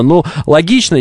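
ordinary delivery

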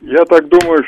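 ordinary talk, nothing marked

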